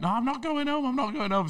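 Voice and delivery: mock stubborn girl. Retelling the girl who won't leave the villa, high mock whine. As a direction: squeak it out like a stubborn party girl digging in.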